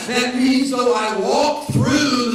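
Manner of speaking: loud, slow, driving sermon cadence; full-force preaching. Acted. Pastor preaching at full volume to the congregation.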